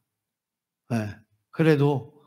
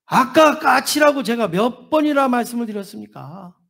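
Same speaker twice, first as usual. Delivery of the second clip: higher, irritated retort; exasperated son; 6 semitones higher than usual. Acted. Son scolding his father about the bird, annoyed. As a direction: lose patience with the old man.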